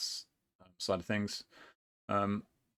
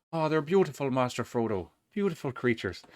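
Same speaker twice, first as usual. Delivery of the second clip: high, loud accidental Irish lilt; Samwise with Irish accent; 6 semitones higher, 4 dB louder than usual. Retold or imitated. Player quotes hobbit admiring the Oliphants, accidental Irish accent. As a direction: put on an excited, hammy Irish accent. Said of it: "Don't know why I went Irish there".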